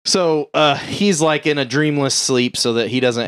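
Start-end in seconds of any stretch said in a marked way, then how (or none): none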